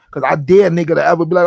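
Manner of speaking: ordinary talk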